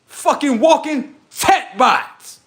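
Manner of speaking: slow high drawn-out insult. Acted. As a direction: drag out each word.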